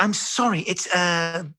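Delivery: high, apologetic producer voice; BBC producer. Retold or imitated. Producer calling back the supposed star, apologetic. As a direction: imitate a flustered caller apologising. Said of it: "He was like".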